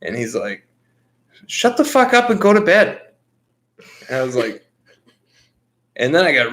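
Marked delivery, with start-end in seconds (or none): 1.5-3.0 high, loud barked order; fed-up father yelling at his son; angry dad. Retold or imitated. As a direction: shout the kid back to bed. Dad angrily sending kid to bed.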